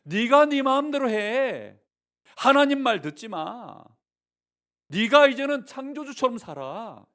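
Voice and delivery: the devil egging the listener on, slowly and loud; tempting devil. Acted. Preacher voicing the devil tempting people, slow and loud. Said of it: "지금 마귀가 말하는".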